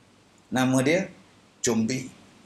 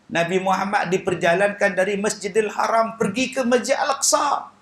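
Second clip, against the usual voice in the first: usual speaking voice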